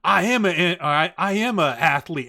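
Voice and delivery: imitated defiant declaration; shouting stripper. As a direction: holler each word proudly from afar. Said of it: "And she's like".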